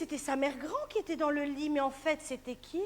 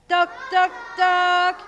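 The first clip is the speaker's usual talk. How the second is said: loud knock, knock; 2 semitones higher, 10 dB louder, slower than usual. Acted. Children knocking loudly at the door. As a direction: rap it out with the class. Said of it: "on dit tous toc".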